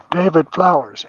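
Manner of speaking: loud call across to the other side; calling a name. Retold or imitated. Medium calling a spirit by name, loud bullhorn call.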